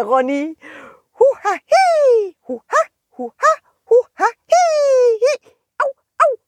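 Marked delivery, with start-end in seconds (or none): 1.2-6.4 a tiny creature straining and squeaking; worm noise. Acted. Worm twisting itself.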